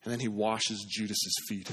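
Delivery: normal talking voice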